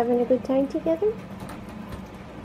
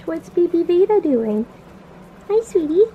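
high, louder cooing; 6 semitones higher, 5 dB louder than usual. Real, not acted. Cooing at pet.

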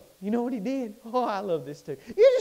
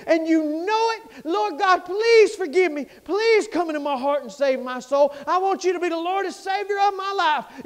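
tearful prayer; 7 semitones higher, 6 dB louder than usual. Retold or imitated. Retold desperate prayer, cry heard.

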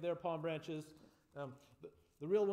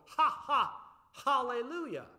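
higher, louder ha ha called through cupped hands; calling out; 7 semitones higher, 8 dB louder than usual. Shown as a demonstration. Leader teaching the calling part of the song, loud. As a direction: call it out like across a field. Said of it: "hands beside your mouth and go".

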